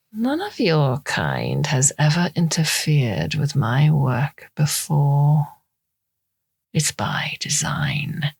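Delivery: slow, hushed, superior villain; menacing villain. Acted. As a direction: drawl each word like you own her. Storm looms over drugged Eve.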